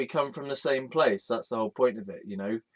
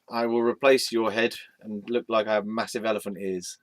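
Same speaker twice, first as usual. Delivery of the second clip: normal talking voice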